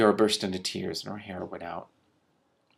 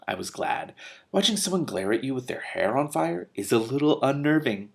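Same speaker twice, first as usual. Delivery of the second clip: ordinary delivery, nothing marked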